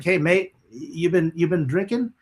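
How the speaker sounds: put-on a tour manager's British accent, casually asking. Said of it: "in a British accent".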